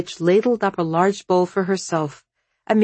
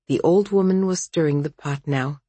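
ordinary delivery, nothing marked

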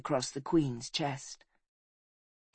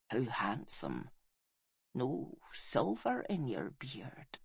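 dying whisper; 2 semitones higher, 8 dB quieter than usual. A narrator's reading. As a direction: whisper tenderly on failing breath. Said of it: "she whispered, smiling".

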